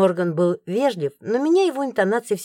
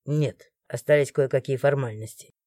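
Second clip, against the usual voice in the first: lower, softer, polite male voice; polite guard; 7 semitones lower, 4 dB quieter than usual. A narrator's reading. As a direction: answer low and courteous. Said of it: "вежливо ответил Морган". (вежливо, низким голосом)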